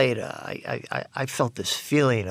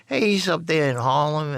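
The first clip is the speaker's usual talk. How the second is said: usual speaking voice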